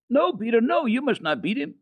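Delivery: put-on girl crying out to stop Peter beating the goat. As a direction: stop him before he strikes. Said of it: "But Heidi cried".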